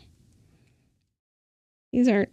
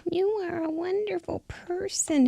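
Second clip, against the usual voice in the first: high, soft little mouse voice; best mouse voice; 8 semitones higher, 4 dB quieter than usual. Pretend. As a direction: say it as a tiny, high-pitched mouse. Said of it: "in your best mouse voice".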